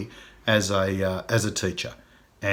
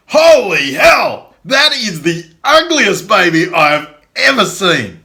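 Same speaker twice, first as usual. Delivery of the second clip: much higher and louder mock shout, slow start; rude bus driver yell; 10 semitones higher, 11 dB louder, slower than usual. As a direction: bellow it like a tactless bus driver. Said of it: "And the bus driver goes".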